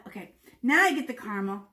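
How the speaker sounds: ordinary delivery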